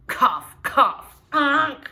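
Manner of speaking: loud mock cough then high honk like a foghorn; imitated cough and honk. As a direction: play the coughing custodian and nose-blowing dad.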